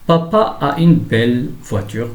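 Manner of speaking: slow, careful sentence reading; slow teacher's model sentence; example read-out. Shown as a demonstration. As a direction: read it out slowly for learners. Teacher reads example sentence aloud.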